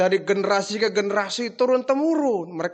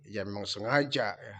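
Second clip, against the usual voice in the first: lower, softer private thought; inner voice; 9 semitones lower, 8 dB quieter than usual. Retold or imitated. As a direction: say it to yourself, barely aloud. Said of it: "Dalam hati".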